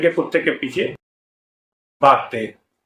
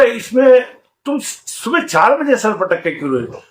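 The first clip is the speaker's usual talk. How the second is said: incredulous question; 7 semitones higher than usual. Acted. Seth asks the crying woman.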